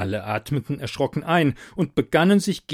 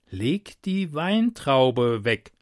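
slow command; much slower than usual. Acted. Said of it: "Anführer des Hinrichtungskomitees".

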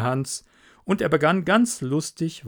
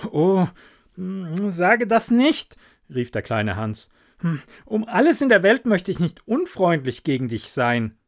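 pleading little Hans; 5 semitones higher, 4 dB louder than usual. Acted. Hans to the miller, pleading not to seem unfriendly. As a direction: protest that you'd never be unkind.

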